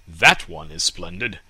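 a man choosing the best tree; brisk man picking a tree; man in the courtyard. Acted.

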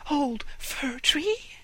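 high, tiny mouse voice; squeaky little mouse. Acted. Mice speak in squeaky voice.

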